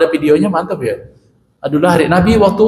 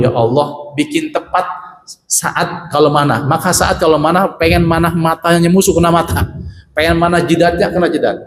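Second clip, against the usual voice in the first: ordinary talk, nothing marked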